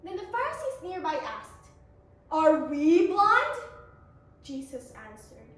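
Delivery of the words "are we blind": loud, slow indignant question; hammy offended challenge. Acted. Pharisees challenge Jesus.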